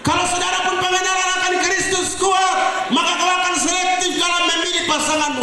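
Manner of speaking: preaching at full force. Real, not acted. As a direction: drive the point home to the crowd. Khotbah dengan suara lantang. (khotbah berapi-api)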